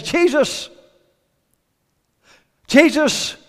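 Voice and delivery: high, loud cry for attention; calling out above a crowd. Acted. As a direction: call the name over the noise of a street. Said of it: "the voice above the crowd".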